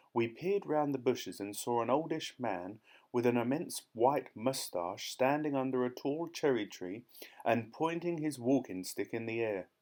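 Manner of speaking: plain reading voice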